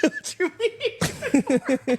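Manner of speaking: high squeal through laughter; laughing mock outrage. Hosts mocking suspicion of kindness, high laughing squeal. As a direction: laugh while playing the suspicious one.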